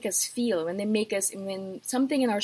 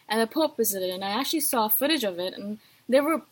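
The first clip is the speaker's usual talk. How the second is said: ordinary talk, nothing marked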